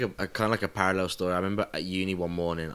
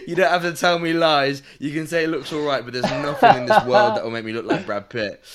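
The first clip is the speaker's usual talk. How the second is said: louder and higher, laughing protest; mock indignant; 6 semitones higher, 10 dB louder than usual. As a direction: tell the barber off with a grin. Mock indignant retelling to barber.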